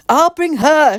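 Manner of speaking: loud high shout. A narrator's reading. Mick calling back while holding Tessa, shouting. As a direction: call it out across the field. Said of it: "shouted Mick".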